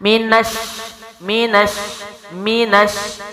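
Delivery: مینش with ن merged into doubled ش; tajweed drill. Shown as a demonstration. Teacher drilling the join for students, short repeated recitation. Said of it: "کیا ہوگا؟".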